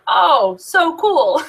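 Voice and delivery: imitating a dry man's attempt at enthusiasm; dry excitement. Imitating the speaker on stage opening a box, drily excited. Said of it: "he's so dry".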